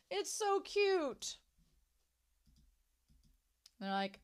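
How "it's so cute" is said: high, slow coo; cute-struck cooing. Real, not acted. Fan melting at the puppy's story, high slow cooing.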